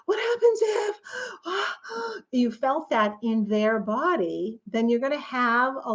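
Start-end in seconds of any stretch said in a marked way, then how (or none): 0.1-1.0 high, panicky what-ifs; scared parent voice, pitched high; panicked parent. Retold or imitated. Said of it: "they're like". 1.1-2.3 sharp high gasp of fear; high startled gasp; frightened gasp. Retold or imitated. Imitating frightened parent.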